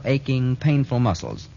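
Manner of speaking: ordinary talk, nothing marked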